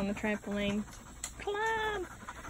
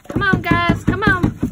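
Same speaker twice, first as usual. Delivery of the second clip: very loud, higher call to the dogs; much louder rallying call; 8 semitones higher, 15 dB louder than usual. Real, not acted. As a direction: shout across the yard for the pack. Calling dogs again.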